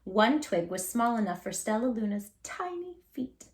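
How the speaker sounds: usual speaking voice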